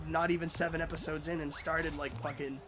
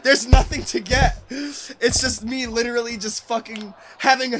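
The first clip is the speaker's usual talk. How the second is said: louder, higher, half-laughing protest; loud laughing insistence; 7 semitones higher, 11 dB louder than usual. Real, not acted. Telling his cohost it's nonsense, loudly and laughing.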